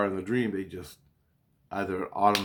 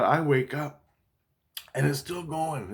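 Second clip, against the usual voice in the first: ordinary delivery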